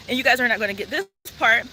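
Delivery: usual speaking voice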